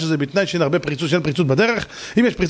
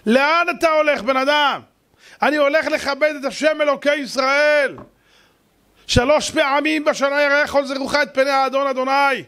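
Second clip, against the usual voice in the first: high, fervent preacher's cry; preaching at full force; 9 semitones higher than usual. Acted. The preacher describes pilgrims going to the Temple, fervent. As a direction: build the crowd up with fire.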